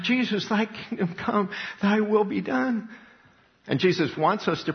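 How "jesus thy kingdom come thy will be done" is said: much higher, slow imploring cry; pleading prayer. Retold or imitated. Preacher voicing believers under the Antichrist, high pleading prayer. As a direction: beg Jesus to come.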